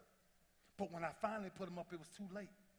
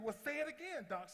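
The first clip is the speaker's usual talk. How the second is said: higher, louder dare; defiant challenge; 9 semitones higher, 4 dB louder than usual. Retold or imitated. Player daring his doctor, higher and louder. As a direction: challenge her to repeat it.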